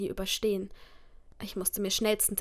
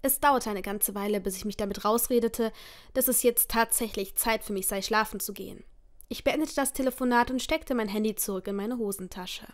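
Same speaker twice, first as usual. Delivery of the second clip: ordinary delivery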